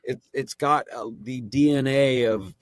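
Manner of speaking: ordinary talk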